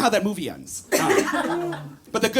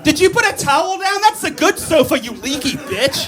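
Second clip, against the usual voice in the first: outraged yelling; 10 semitones higher than usual. Acted. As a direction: tear into her, half laughing.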